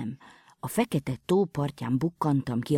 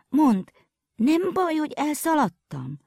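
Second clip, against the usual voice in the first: put-on anxious girl; 11 semitones higher than usual. A narrator's reading.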